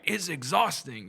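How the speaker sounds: a hint of film impression; lightly imitated movie speech. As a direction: quote the weary film line, drawn out. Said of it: "like, Selena speech from the movie".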